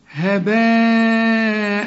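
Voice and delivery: هباء drawn out very long; very slow recited هباء; recited with a long alif. Shown as a demonstration. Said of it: "إذا وقفت تديها ألف كاملة".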